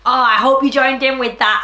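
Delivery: plain reading voice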